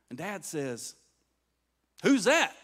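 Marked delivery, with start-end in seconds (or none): 2.0-2.5 loud, raised challenge to an unseen visitor; acted blind father calling out; startled calling out. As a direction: call out sharply, higher and louder.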